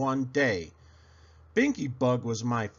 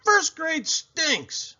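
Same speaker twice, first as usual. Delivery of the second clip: much higher, slow, drawn-out whine; sulky kid verdict; 4 dB louder, much slower than usual. A narrator's reading. Girl declaring her new grade awful, slow high whine. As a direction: stretch it out like a pouting child. Muttered complaint.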